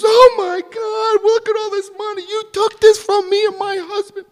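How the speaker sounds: put-on high old woman in dismay; shocked old woman. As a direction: quaver it like a stunned elderly widow.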